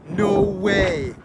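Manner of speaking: normal talking voice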